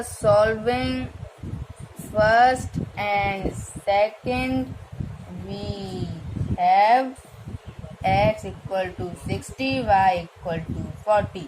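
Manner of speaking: drawn-out, word-by-word dictation pace; slow dictation. Shown as a demonstration. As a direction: dictate slowly as you write it on the board. Teacher dictates the result. (धीमे-धीमे लिखवाते हुए बोलना)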